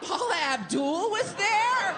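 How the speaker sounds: high, slow amazed question; stunned surprise. Retold or imitated. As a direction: gasp it in disbelief. Stunned retold reaction.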